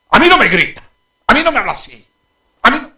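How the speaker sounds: put-on furious shout of a defiant kid; angry child shouting. Child shouting at his father in anger. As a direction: yell it at the father, offended. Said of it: "¿Cómo él le habla?".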